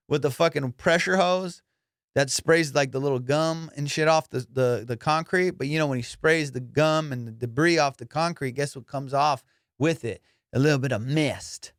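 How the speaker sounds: usual speaking voice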